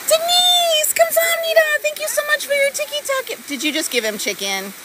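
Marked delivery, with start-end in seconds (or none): 0.1-3.5 squeaky high thank-you voice; cutesy high gratitude voice. Acted. High cute voice thanking donors.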